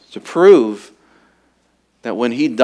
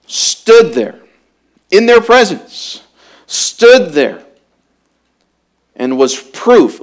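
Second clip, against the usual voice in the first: higher, louder, building preacher's cry; preaching at full force; 5 semitones higher, 8 dB louder than usual. Acted. Preacher proclaiming the healed beggar as proof, full force.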